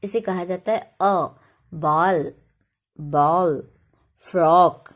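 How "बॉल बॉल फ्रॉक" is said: बॉल and फ्रॉक said loud with a clear open aw; open aw vowel. Shown as a demonstration. Teacher drilling the aw vowel for the class, emphatic. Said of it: "तो इसे ऐसे पढ़ते हैं".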